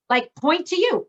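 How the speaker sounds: ordinary talk, nothing marked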